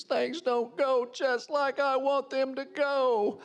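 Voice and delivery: high, slow self-pitying whine; whining. Acted. Preacher mimicking our pity party, slow mock whine. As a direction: whine it through a pout, drawn out. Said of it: "a whining pity party".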